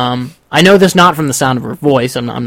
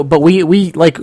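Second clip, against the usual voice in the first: usual speaking voice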